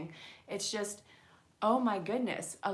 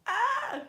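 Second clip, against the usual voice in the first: sharp high yelp of surprise; sudden high startled cry; 7 dB louder than usual. Real, not acted. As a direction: yelp as the card jumps. Card jumps out of deck.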